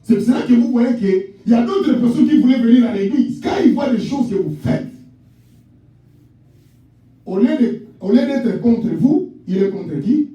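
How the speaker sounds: high, shouted sermon over music; preaching at full force. Acted. Pastor exhorting beloved congregation, shouted high preaching. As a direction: proclaim to the whole church.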